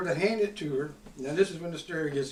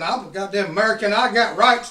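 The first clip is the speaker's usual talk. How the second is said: louder yell of his rights; hollering; 3 semitones higher, 8 dB louder than usual. Retold or imitated. Retelling hollering at customs guards, loud yell. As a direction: holler it at the whole room. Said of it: "I'm hollering".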